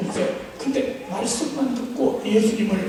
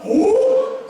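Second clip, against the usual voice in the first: high, louder "오?"; mock surprise; 11 semitones higher, 4 dB louder than usual. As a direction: act like you recognise them. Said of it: "아는 척 하려고". (높게 놀란 척하는 "오?")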